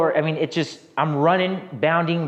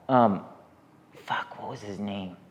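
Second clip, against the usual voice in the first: ordinary delivery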